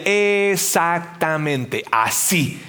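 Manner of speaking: very slow, drawn-out syllables; slow, deliberate imagined reply; emphatic confirmation. Acted. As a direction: stretch it out syllable by syllable. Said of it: "Entonces Pablo te diría".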